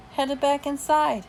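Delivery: ordinary delivery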